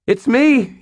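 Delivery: put-on a scared kid yelling to his boss; high panicked shout; frantic call. A narrator's reading. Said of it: "he called out frantically".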